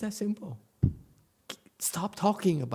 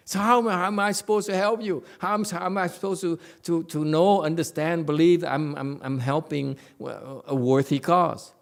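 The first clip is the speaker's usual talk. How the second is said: mock exasperation; 4 semitones higher, 6 dB louder than usual.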